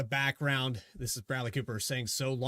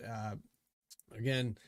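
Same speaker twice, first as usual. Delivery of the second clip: ordinary talk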